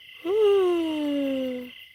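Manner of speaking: long high yawning sigh; big yawn. Real, not acted. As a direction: let out a huge yawn. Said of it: "Is that why I'm yawning?".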